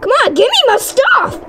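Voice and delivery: high, impatient whine-yell; exasperated demand. Real, not acted. Player out of ammo demands stuff from game, exasperated demand. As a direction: demand it, pitch jumping way up.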